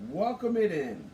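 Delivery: ordinary delivery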